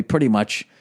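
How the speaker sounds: ordinary talk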